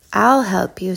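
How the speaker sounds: usual speaking voice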